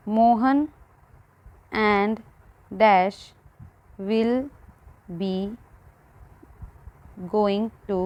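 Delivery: word-by-word dictation with long pauses; halting, measured classroom dictation; slow dictation. Acted. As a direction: read it out slowly as if writing each word down. Dictates example sentences word by word.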